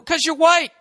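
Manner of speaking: mock casting director. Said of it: "And then".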